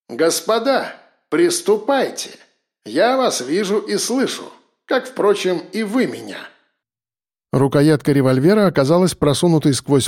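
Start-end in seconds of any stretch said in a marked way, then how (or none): 0.1-6.4 thin, filtered PA-speaker voice, higher; voice over loudspeaker. A narrator's reading. Professor addresses prisoners from the ceiling speaker, amplified voice. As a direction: announce it through a ceiling speaker. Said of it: "из динамика под потолком загремел его голос".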